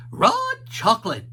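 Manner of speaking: high, loud dog voice; Scooby voice. A narrator's reading. Scooby excited about chocolate, high and loud. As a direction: say it like a hungry cartoon dog. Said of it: "said Scooby".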